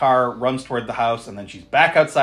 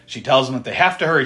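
ordinary talk

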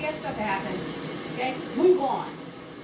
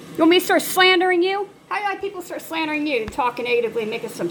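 louder and louder, a heated real rant; passionate rant; 5 dB louder than usual. Real, not acted. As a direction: let the frustration build into loud scolding. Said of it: "I'm sorry I'm passionate about this".

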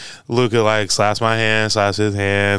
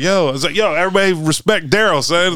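hype shout-out; 7 semitones higher than usual. Retold or imitated. Hyping Daryl to crowd.

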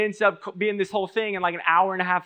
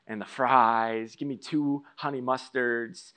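slow ordering; 5 semitones lower, 5 dB quieter, slower than usual. Retold or imitated. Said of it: "and I'll say".

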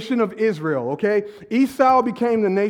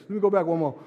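ordinary talk

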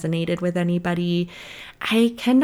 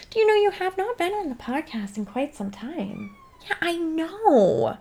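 playful voice; 8 semitones higher than usual. Pretend. Dialogue with imaginary Rebecca.

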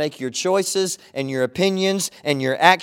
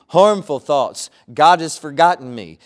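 preaching at full force; 5 dB louder than usual. Acted. Pastor preaching to his church, loud and emphatic. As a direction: proclaim each line to the congregation.

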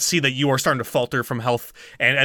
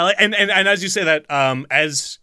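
ordinary delivery

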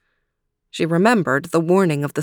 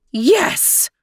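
triumphant shout; 10 semitones higher than usual. Acted. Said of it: "shouted the queen".

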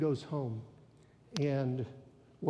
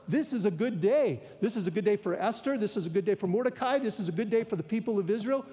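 higher, louder, fast rising joy; excited proclaiming; 5 semitones higher, 4 dB louder, faster than usual. Real, not acted. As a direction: build the celebration line by line. Preacher builds joyful declaration.

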